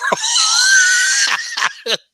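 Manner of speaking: high loud burst of laughter; loud shrieking laugh. Real, not acted.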